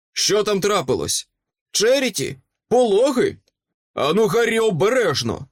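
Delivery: high, urgent calling out; alarmed shout. A narrator's reading. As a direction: shout in worried alarm, pitch way up. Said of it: "крикнув лицар". (стривожено вигукує, високим голосом)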